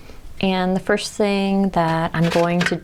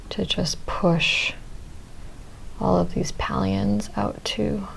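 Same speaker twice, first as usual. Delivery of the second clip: whispering; 2 semitones higher, 6 dB quieter than usual. Real, not acted. Careful close work.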